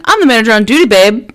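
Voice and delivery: punchy mock-boastful retort; sassy retort. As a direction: put the customer in their place, loudly. Retelling sassy retort to customer.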